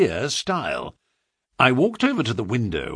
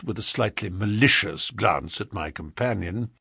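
plain reading voice

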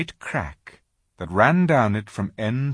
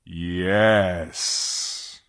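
a lightly deepened lion's voice; great voice. Acted. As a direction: answer calmly, with authority. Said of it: "said a great voice".

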